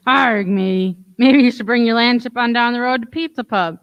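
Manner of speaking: ordinary talk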